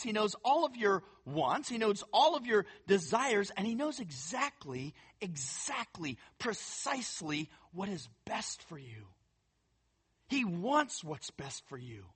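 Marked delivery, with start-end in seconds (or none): 3.6-12.0 soft reassurance. Real, not acted. Preacher drops to intimate close.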